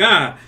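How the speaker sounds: short loud startled yelp; startled cry. Retold or imitated. Burnt by the barbecue fireball, startled yelp. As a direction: yelp at the sudden flame. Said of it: "I was like".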